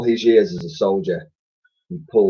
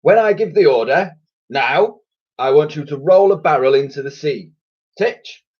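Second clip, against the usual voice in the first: commanding admiral; 6 semitones higher, 5 dB louder than usual. A narrator's reading. Admiral giving orders.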